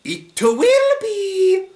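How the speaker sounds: put-on very high, loud Italian-accented wail; soaring, drawn-out Italian accent; Ghidorah. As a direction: declare it slowly in a hammy Italian accent. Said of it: "doing an Italian accent for Ghidorah".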